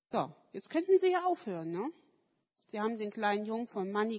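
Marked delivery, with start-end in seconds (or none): none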